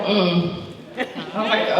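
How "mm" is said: mother's mm. Retold or imitated. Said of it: "my mom was like".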